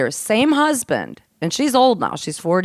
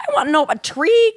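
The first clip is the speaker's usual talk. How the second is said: mock-incredulous refusal, pitch jumping up then falling; dismissive disbelief; 9 semitones higher, 4 dB louder than usual. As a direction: scoff at the very idea, replaying it. Acting out her refusal to son.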